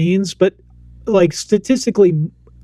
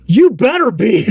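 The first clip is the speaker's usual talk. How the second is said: high, swooping retort with laughter; playfully indignant comeback; mock scolding; 5 semitones higher, 3 dB louder than usual. Parent answering Amy's apology, mock scolding.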